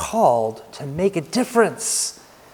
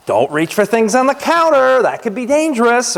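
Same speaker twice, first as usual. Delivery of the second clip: put-on raised, higher warning voice of a dad to a toddler; mock-stern dad warning; parental warning; 8 semitones higher, 4 dB louder than usual. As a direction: warn the little boy from across the room.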